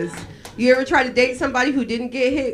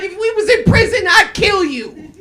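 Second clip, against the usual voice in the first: loud, raised-pitch comic threat; mock angry threat; 9 semitones higher, 5 dB louder than usual. Answering the culprit's excuse with a threat, mock fury. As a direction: threaten in exaggerated outrage, slowing at the end.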